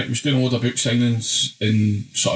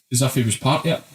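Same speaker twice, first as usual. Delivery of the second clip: ordinary talk, nothing marked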